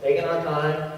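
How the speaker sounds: normal talking voice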